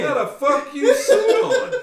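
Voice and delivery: laughing disbelief. Real, not acted. Friend cracking up over the fighter's stunt, laughing loud and high.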